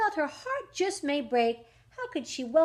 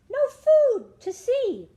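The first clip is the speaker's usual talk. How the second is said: dismayed cry; 10 semitones higher, 8 dB louder, slower than usual. Acted. Cricket discovers empty pantry.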